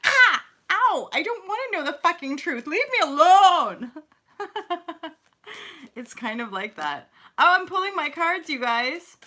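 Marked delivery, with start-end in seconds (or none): 0.0-3.9 high, loud whiny protest; mock whining. Acting out people refusing the truth, whiny. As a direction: whine like someone hurt by the truth. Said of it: "we're like".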